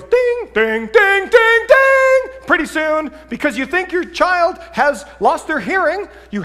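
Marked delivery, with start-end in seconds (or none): 0.0-2.2 alarm ding. Retold or imitated. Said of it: "parent escalation alarm".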